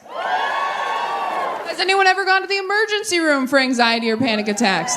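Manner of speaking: ordinary talk, nothing marked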